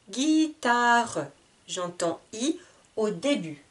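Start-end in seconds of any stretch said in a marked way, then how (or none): 0.1-1.3 "Guitare" in three long beats, Gui-ta-re; split into syllables. Shown as a demonstration. Teacher showing three syllables, "Guitare" drawn out. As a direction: voice the final e of "Guitare". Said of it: "Trois syllabes".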